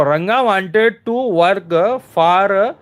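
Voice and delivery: very slow, stretched reading of the answer sentence; slow read-aloud. Shown as a demonstration. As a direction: drag each word out for the class. Teacher reads the answer sentence.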